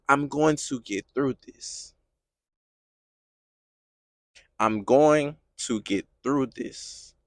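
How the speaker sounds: very low, quiet, halting affirmation; hushed resolve. Acted. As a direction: say it softly through the pain, with long pauses. Voicing a hurting believer's resolve.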